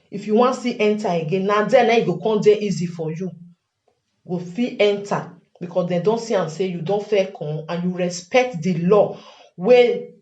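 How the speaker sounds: ordinary delivery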